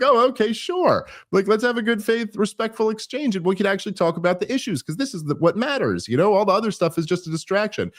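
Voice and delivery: high-pitched, trusting eagerness; mock your own gullible willingness to play nice; naively agreeable. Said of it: "a naive romantic in my heart of hearts".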